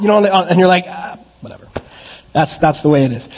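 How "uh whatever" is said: whispered, unimpressed brush-off; bored parent. Retold or imitated. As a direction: shrug it off under your breath. Parent unimpressed by kid's secret.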